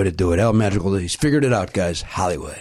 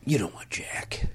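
slow conspiratorial whisper; 9 dB quieter, slower than usual. Real, not acted. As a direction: whisper it like a secret.

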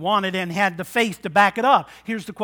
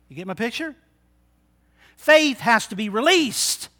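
usual speaking voice

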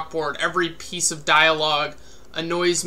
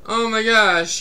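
high, loud gushing fan voice, fading toward the end; mocking excited fan who fades out; 5 semitones higher, 5 dB louder than usual. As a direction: parody a fan raving over the film. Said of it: "and they're like".

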